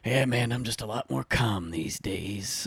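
slow, soft, laid-back imitation; calm. Retired cop cousin at a funeral, calm. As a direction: talk like you've let all the stress go. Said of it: "he sounded so calm".

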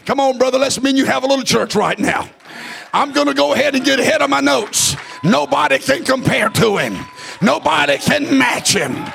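whooping preacher, sung and shouted; preaching. Acted. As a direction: chant the names of God, rising. Preaching climax with music.

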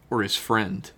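normal talking voice

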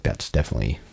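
hushed. Retold or imitated. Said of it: "I was like".

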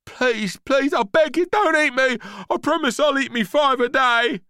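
high, loud, frantic plea; terrified begging. Acted. Boy surrounded by monsters, pleading in panic. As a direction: beg for your life at the top of your voice.

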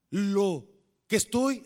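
ordinary talk

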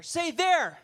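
loud, high prompt for a response; preacher calling the crowd to repeat a word. Real, not acted. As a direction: bark it out so they answer back.

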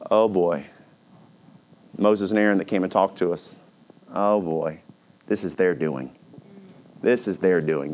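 low, sinking mutter of dread; mock dejection. Israelites realizing who is to blame, dejected.

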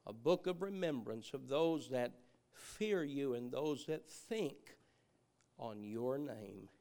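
low, soft public prayer with long pauses; hushed, measured address to God; closing prayer. Real, not acted. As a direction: pray reverently, phrase by phrase.

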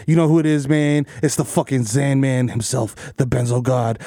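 normal talking voice

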